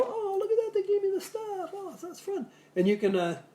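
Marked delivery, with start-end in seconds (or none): 0.0-2.5 high squeaky mock delight; mock delighted friend. Friend opening a gift basket, high mock delight. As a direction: squeal it like a thrilled gift receiver. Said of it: "people would go".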